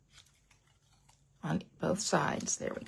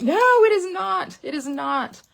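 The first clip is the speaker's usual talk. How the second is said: much higher, louder, slow insistent no; emphatic refusal; 11 semitones higher, 8 dB louder, slower than usual. Retold or imitated. To herself about ribbon, a hammed-up loud refusal. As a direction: put your foot down, playfully hammed. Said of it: "And then I'm like".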